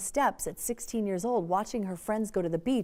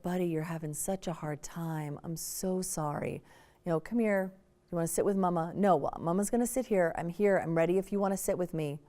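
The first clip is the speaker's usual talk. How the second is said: soft soothing mom; 5 semitones lower, 5 dB quieter than usual. Retold or imitated. Mom soothing upset son.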